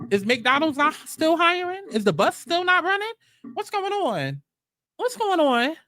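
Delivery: high sarcastic is the bus still not running; mock incredulity. Real, not acted. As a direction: play dumb in disbelief, high. Mocking incredulous questions.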